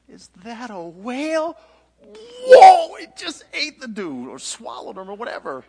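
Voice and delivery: high question bursting into a very loud whoa; hammed-up high-pitched shock; astounded onlooker. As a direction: react wildly to a monstrous sight. Sailors watch fish swallow Jonah.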